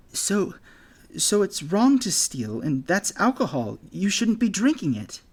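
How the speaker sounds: high, halting voice, rallying courage; nervous boy. Acted. Jack scolding the bully over the flask, nervous and high. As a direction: stammer it out, then push on.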